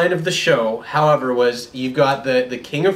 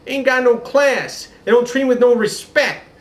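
put-on brassy New York street accent, pitched high; New York brothel accent; 9 semitones higher, 3 dB louder than usual. The king's mistress mocked in a New York accent. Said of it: "sounded like she was from a New York brothel".

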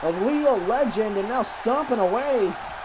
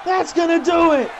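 shouted count; 7 semitones higher, slower than usual. Acted. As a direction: count the pin at full voice. Calling the pinfall count.

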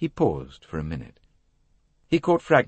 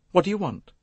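put-on higher, curt young woman; ungracious; 13 semitones higher than usual. A narrator's reading. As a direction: challenge the unwelcome caller. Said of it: "she said ungraciously".

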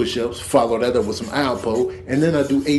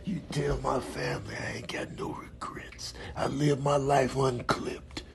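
hushed whispered last words; dying whisper; 13 dB quieter than usual. Acted. Cat giving dramatic last words, faint whisper.

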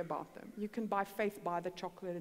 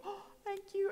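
tearful thanks; 10 semitones higher than usual. Retold or imitated. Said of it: "she starts crying".

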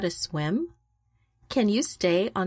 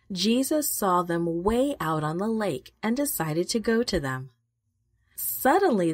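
plain reading voice

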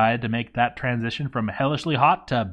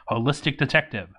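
ordinary talk, nothing marked

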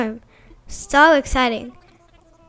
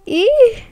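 high, loud little squeal; excited squeal; 9 semitones higher, 5 dB louder than usual. Real, not acted. A girl squealing at her game villager.

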